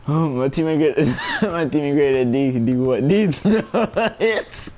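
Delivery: punchline climbing into high shrieking laughter; real giggles breaking up the joke; laughing hard. Real, not acted. Streamer replaying his prank to viewers, laughing hard. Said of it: "I'm laughing so hard".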